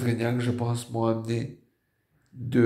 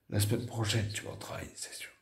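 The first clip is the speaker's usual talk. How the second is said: soft unvoiced whisper to himself; whispered inner thought; 9 dB quieter than usual. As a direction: whisper it like a private hope. (pensée intime chuchotée)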